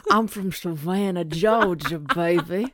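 hammed-up Southern accent. Playing the Southern heroine for her cohost, hammy Southern accent. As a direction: play a Georgia girl in a Southern accent. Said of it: "you're gonna do a Southern accent".

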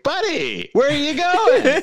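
high, drawn-out mock call; comic high-pitched shout, stretched out. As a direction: holler after someone fleeing, hammed up. Acting out movie character calling out.

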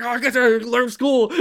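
high, loud hammed-up panic; frantic dad. Dad parking his son on Zoom class, high loud panic. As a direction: blurt it like a frazzled dad dumping his son. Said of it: "And he's like".

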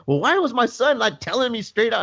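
high, indignant imitation of a father; mock-angry complaint, pitched well up; retold mad dad. Dad getting mad at paramedic, indignant. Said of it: "he starts getting mad at me".

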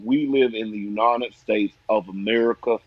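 low Southern drawl; mock Louisiana politician's Southern accent. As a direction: imitate a Southern senator's drawl. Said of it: "they always got that Southern drawl".

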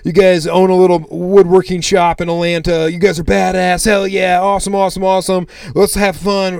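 pumped-up, friendly hype; hyped-up greeting. Retold or imitated. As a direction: act out chummy enthusiasm for new acquaintances. Acting out hyped chat with customers.

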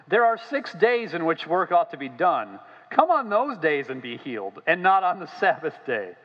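loud, high, indignant scolding; indignant outburst. Acted. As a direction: bark the rule like a furious official. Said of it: "very calmly, I'm sure".

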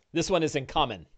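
usual speaking voice

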